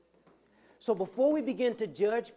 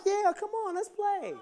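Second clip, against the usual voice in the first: put-on little girl voice; 13 semitones higher, 4 dB louder than usual. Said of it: "She was like".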